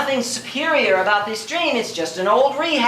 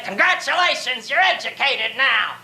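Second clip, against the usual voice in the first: mock congratulations; 2 semitones higher than usual. Whoever filled the skull congratulates the pupil, mock congratulations. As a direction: put on a voice to hand out hollow congratulations. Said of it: "shake well and say".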